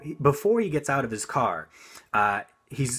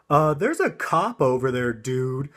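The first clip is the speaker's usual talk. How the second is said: retold shout; 3 semitones higher, 5 dB louder than usual. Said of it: "they just shout out their car window".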